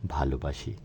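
low, soft voiced near-whisper; murmured sweet nothing. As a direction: murmur it softly into a lover's ear. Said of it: "কানে কানে ফিসফিস করে বলবো".

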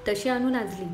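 ordinary delivery

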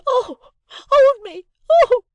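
very high, loud drawn-out shrieks; shrieking hysterics. A narrator's reading. Sister works herself into fury, shrieking. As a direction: shriek it slowly in a frenzy.

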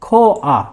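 খো আ said as two separate beats, kho then a; demonstrating Korean syllables. Shown as a demonstration. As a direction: pronounce খো আ carefully, a small break between kho and a. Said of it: "Bangla উচ্চারণ হচ্ছে".

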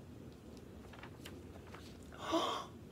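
big voiceless intake of surprise; theatrical surprised gasp; acted gasp. A narrator's reading. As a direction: gasp in storybook wonder. Surprise as the eggs hatch.

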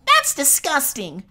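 a small fairy grossed out; appalled fairy. Acted. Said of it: "sounding appalled".

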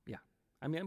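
ordinary delivery

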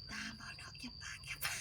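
hushed secret whisper. Real, not acted. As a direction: whisper it so nobody hears. Secret numbers, don't tell anyone.

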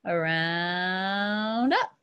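very slow "Around", gliding into "up"; drawn-out tracing word. Real, not acted. Teacher tracing little o for students, drawn-out word. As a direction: stretch "Around" for the whole pencil stroke.

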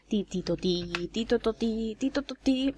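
softly voiced little jingle; homemade sound effect. Player making her own sound effects for the second challenge, playful jingle. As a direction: sing out a makeshift game jingle. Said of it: "crear yo los efectos de sonido".

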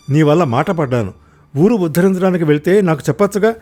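a loud, sarcastic outburst; angry tirade. Acted. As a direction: rant at her sarcastically.

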